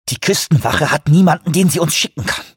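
high, tense hiss of frustration; seething, high-pitched complaint; angry hissing. A narrator's reading. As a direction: hiss it out in exasperation. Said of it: "zischte Shoemaker".

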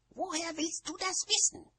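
high, hissing witch voice; high-pitched angry hiss; hissed retort. A narrator's reading. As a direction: hiss the question back indignantly. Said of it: "zischte Nanny".

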